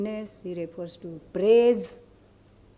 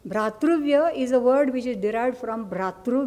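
usual speaking voice

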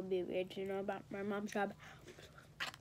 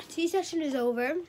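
upbeat sign-off; 5 semitones higher, 7 dB louder than usual. Acted. Closing the gossip segment.

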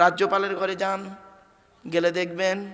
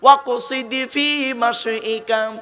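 slow, drawn-out chanted Arabic verse; Quranic recitation; 6 dB louder, much slower than usual. Shown as a demonstration. Preacher reciting a verse to listeners, slow and chanted. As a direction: recite the verse slowly to the congregation. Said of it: "শুনে নিন।".

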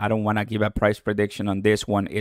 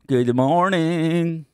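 higher, slow sing-song good morning; drawn-out greeting; 6 semitones higher, much slower than usual. Real, not acted. Livestreamer greeting viewers as they join, higher drawn-out greeting. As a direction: stretch the greeting out, lifting the pitch.

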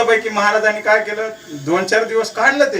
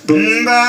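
imitated drawn-out moo; cow mooing; 4 dB louder, much slower than usual. Cow breaking the holy man's meditation, imitated moo. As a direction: bellow a nasal "mbaa" like a cow. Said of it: "ते ओरडायला लागलं".

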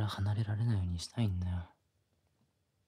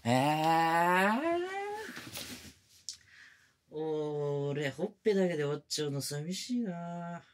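higher whiny pout; sulky pleading; 7 semitones higher than usual. Acted. Junior pouting and pleading with senpai. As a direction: sulk to win her over.

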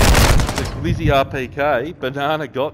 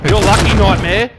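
loud taunt; 6 semitones higher, 9 dB louder than usual. Real, not acted. Taunting an enemy player in game.

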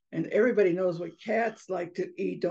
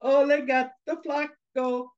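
higher, slow taunting chant; teasing taunt; 5 semitones higher, 4 dB louder, slower than usual. Acted. Mice really teasing the fallen cat, taunting. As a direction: stretch it out to tease the cat. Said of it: "really started to tease that cat".

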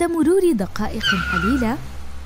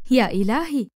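mildly startled exclamation; fearful gasp. A narrator's reading. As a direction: read it with a touch of fright. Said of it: "شهقت سندس بخوف". (شهقة خوف خفيفة)

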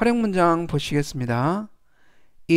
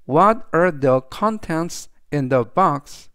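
very slow, deliberate example sentence; slow model reading; much slower than usual. Shown as a demonstration. Teacher reading the example aloud to students, slow and clear. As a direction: spell each word out for learners.

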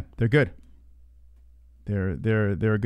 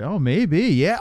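normal talking voice

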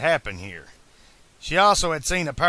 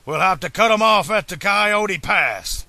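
loud, high-pitched excited character; raised, rallying character voice; urgent Doc; 5 semitones higher, 5 dB louder than usual. A narrator's reading. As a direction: call out the plan urgently, louder and higher.

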